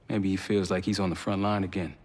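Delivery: ordinary talk, nothing marked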